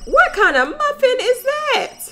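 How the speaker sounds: high, slow incredulous squeal; voicing a Sim shocked at her pricey muffin; outraged disbelief. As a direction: shriek it like you can't believe it.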